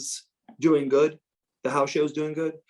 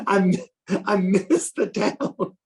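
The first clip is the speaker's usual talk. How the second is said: laughing; 5 semitones higher, slower than usual. Retold or imitated. Laughing while quoting Ron.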